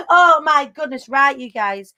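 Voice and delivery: normal talking voice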